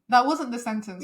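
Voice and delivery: usual speaking voice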